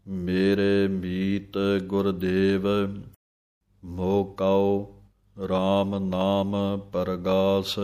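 slow, measured chant-like reading of scripture; gurbani recitation. Acted. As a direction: recite each phrase slowly and devoutly.

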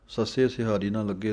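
ordinary talk

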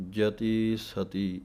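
ਜਤੀ ਸਤੀ said very slow and light; ਜਤੀ ਸਤੀ soft and unhurried; soft reading. Shown as a demonstration. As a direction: ease gently through ਜਤੀ ਸਤੀ. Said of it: "ਇਹ ਪਾਠ ਪੋਲੇ ਪੜ੍ਹਨੇ ਹਨ".

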